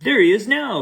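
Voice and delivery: high, loud cry; baker cries out. Acted. Baker spots his cookie on the hill, crying out loud and high. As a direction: point and cry it out. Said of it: "cried the baker".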